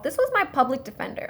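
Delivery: ordinary talk, nothing marked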